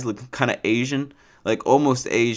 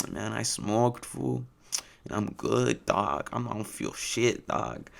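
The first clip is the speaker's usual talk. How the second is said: Chris; 8 dB quieter, slower than usual. Retold or imitated. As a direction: quietly do a faded homie's accent. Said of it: "I'm not just doing this accent".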